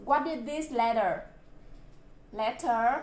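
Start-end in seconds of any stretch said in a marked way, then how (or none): none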